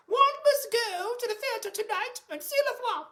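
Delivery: high, plummy royal voice calling Philip; posh Queen voice. Pretend. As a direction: play the Queen summoning her husband. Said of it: "I don't know why I'm talking like that".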